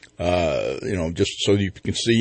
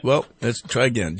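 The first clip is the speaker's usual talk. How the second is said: ordinary delivery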